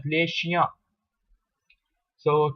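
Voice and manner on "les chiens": les chiens said "lay shia", no final s; silent plural S. Shown as a demonstration. Teacher giving the dog example, silent-s pronunciation. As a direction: say les chiens leaving both s endings silent. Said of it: "but you pronounce it as".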